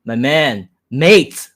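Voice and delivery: usual speaking voice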